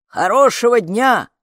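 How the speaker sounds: loud quick cheery blurt; blurted farewell. A narrator's reading. Courier blurts goodbye to the family, loud quick. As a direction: toss it out and flee. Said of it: "выпалил он".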